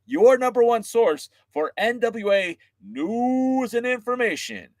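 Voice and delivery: slow, high host pitch; upbeat wrestling podcast host read; podcast host intro. Acted. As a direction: sell the show to the fans.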